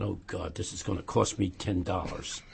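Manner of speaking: low, very soft retold thought; inner thought aside. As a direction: mutter it to yourself, resigned. Retold private thought, muttered aside.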